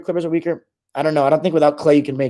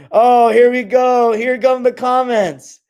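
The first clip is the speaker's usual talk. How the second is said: slow, high, louder drawn-out tease; mock dread; 8 semitones higher, 4 dB louder, much slower than usual. Streamer teasing the chat as angry comments arrive, slow and mocking. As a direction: stretch it out, savoring the coming storm.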